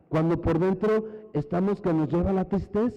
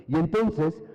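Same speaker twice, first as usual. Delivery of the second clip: usual speaking voice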